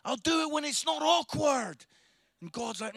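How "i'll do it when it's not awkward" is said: drawn-out whiny objection, getting louder; hammed-up wail of reluctance; mock reluctant protest. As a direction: argue back like a reluctant child. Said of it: "this debate with the Lord where you're like".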